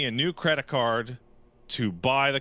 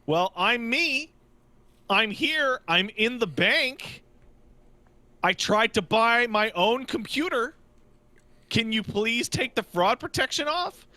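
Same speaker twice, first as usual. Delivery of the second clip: exasperated; 8 semitones higher than usual. Retold or imitated. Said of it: "I'm like".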